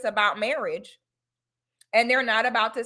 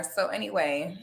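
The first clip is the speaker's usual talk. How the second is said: ordinary delivery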